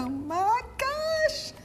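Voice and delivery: high, slow oh my gosh; drawn-out delight. Real, not acted. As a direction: swoon over the food. Tasting the veggie pie.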